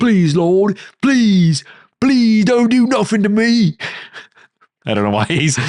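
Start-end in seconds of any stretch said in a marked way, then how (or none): 0.0-3.8 high, pleading Cockney minion, slow on the last plea; a terrified little Cockney henchman begging his master; Cockney accent. Acted. A cowering Cockney henchman begging the dark lord, high and pleading. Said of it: "he's got a Cockney accent".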